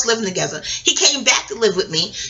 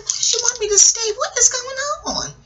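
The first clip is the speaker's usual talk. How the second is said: high confused wondering; 12 semitones higher than usual. Retold or imitated. Retelling her own confusion.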